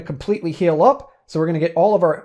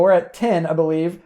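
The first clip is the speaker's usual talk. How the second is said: ordinary delivery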